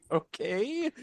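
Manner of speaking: high, questioning reaction of disbelief; baffled okay. Retold or imitated. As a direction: react as if weirded out, voice lifting. Acted baffled reaction to odd answer.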